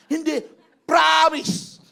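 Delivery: high, loud, stretched-out vow; emphatic shouted promise. Acted. Preacher acts Peter insisting to doubters, emphatic shout. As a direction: belt out the oath.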